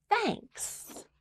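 a shy little bear's thanks. Acted. Bear thanking Mouse for the pie, shy and high. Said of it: "and Bear says".

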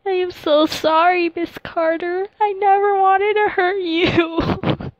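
high, slow, sobbing plea; crying apology. Acted. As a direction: weep through the apology. BMJ weeping apology to [name].

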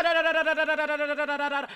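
high, loud frantic babble; panicked da da. Real, not acted. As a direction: blurt syllables in pure panic. Panicked humming while fleeing.

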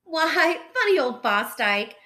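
loud admiring exclamation; crying out. A narrator's reading. Four foxes praising Fosdyke, crying out. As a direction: exclaim with sudden appreciation. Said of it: "the four of them cried".

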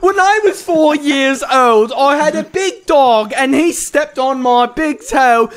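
goofy author voice. Acted. Mocking the friend's long guide reading, goofy author voice. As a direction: ramble pompously in a high goofy voice. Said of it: "It's just a goofy voice".

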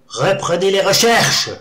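loud, high, slow command; commanding order. A narrator's reading. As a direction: order them back to work. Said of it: "ordonna le Dojguluk".

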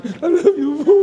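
high, loud, tender little "I love you po"; child shyly saying I love you to his father; sweet child's thanks. Retold or imitated. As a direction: play the child telling a parent he loves him. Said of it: "Pagkahawak ni Arwin".

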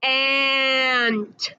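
"ant" with a long held a; slow phonics "ant", vowel stretched; stretched-out word. Shown as a demonstration. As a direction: draw out the a in "ant" before a crisp t. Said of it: "We say".

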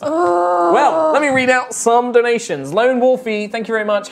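bright stream host voice; hosting the stream thanks; announcer read. Real, not acted. Reading donations.

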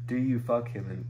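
usual speaking voice

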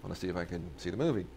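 a lightly voiced faded star, quiet and fast; Stuart Whitman casual. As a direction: toss it off like he expects to be known. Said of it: "And he's like".

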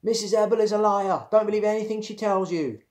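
high, loud holler from the doorway. A narrator's reading. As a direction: yell it out across the house.

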